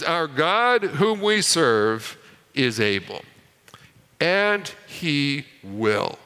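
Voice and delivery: every phrase slow and weighted, "is able" dropping low; a hint of a father's solemn slow motto. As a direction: lay the words down slowly like a creed. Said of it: "the way he said it was".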